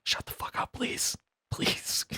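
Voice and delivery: hushed, breathy shut up; whispered plea. Retold or imitated. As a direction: whisper it, begging them to stop. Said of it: "I'm like".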